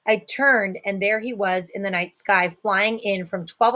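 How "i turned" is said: plain reading voice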